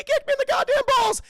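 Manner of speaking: high, loud, hammed-up indignation; outraged mock yell. Streamer voicing a guard kicked by Batman, outraged yelling. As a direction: screech it as a wronged tough guy.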